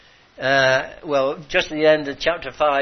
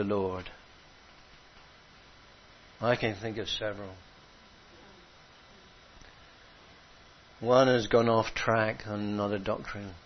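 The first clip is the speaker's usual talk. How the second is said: much softer, grieving close; hushed sorrowful preaching; 4 semitones lower, 7 dB quieter than usual. Acted. Preacher mourning those who left, hushed and sad. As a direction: lower the voice to grieve for the lost.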